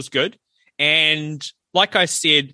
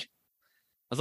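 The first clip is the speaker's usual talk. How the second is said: usual speaking voice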